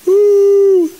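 loud, high "uh" whoop. Real, not acted. Preacher breaks his pause for the congregation, high whoop. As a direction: let out a sustained high hoot.

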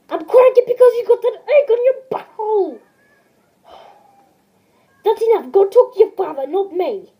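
loud, high, exasperated shouting; angry yelling. Acted. Chef yelling at Junior to bother his father, angry. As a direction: bark him away, fed up.

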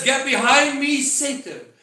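loud, high stern rebuke; preacher voicing an angry rebuke. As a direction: thunder it out as a sharp command. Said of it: "the wrath of our Lord".

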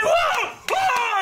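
high, far mock whoa of a thrilled fan; excited whoa. Z-Man's excitement at the poster, high mock whoa. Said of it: "He's like".

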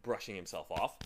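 usual speaking voice

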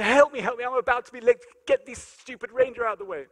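high, loud desperate yelling; retold screaming. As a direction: shriek it while being mobbed. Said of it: "And I'm going".

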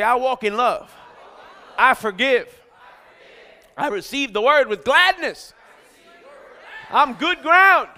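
loud congregational call-out, building to a shout; rousing declaration. Real, not acted.

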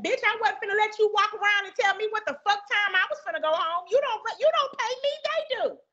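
high-pitched heated rant at the customer; retold heated rant. Cashier telling off the customer, high and heated. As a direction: tell her off, voice climbing high.

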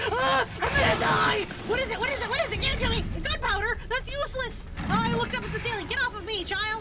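real panic, yelling at everything; terrified screaming. Real, not acted. Chased by a monster, screaming.

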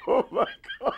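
very high, laughing exclamation; laughing mock dismay. Retelling wife's reaction to dinner guest, laughing. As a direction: imitate her dismay while cracking up.

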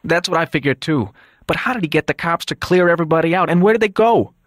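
high, urgent, rising alarm; agitated disbelief. Acted. Son on the phone about missing guards, agitated.

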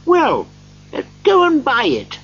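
put-on high-pitched, excited old Scrooge; eager Scrooge. A narrator's reading. Scrooge sends the boy to buy it, excited.

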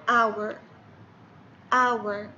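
"اور" repeated with no h sound at all; listen-again demo; silent h. Shown as a demonstration. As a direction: say "اور" twice, vowel first, no h. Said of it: "اسمعوا مرة ثانية".

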